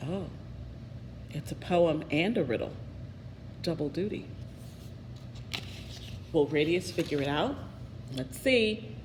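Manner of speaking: soft, low musing to the listener; quiet aside. Real, not acted. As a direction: lean in and wonder aloud. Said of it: "narrator aside to listener".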